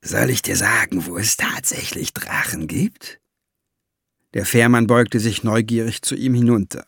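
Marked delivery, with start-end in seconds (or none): 0.0-3.2 conspiratorial whisper; dwarf lures the ferryman closer, whispering; lowered voice. Acted. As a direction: drop into a secret whisper. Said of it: "Der Zwerg senkte die Stimme".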